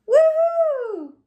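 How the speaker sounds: high, loud joyful whoop; a big happy woohoo; excited cheer. Acted. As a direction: throw your voice up in delight. Character cheers about tutu.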